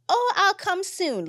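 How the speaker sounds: high, slow and easygoing "oh, I'll come soon"; breezy reply. Shown as a demonstration. Host demoing a relaxed answer to people asking, breezy. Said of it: "I'm gonna stop telling them".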